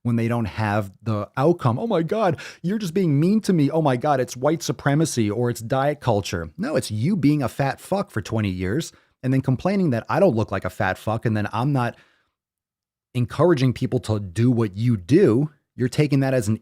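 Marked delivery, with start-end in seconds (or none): none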